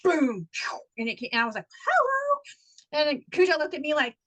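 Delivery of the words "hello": high, held-out hello; indignant hello, pitched way up; incredulous hello. Retold or imitated. Reacting to sudden downpour, high incredulous call.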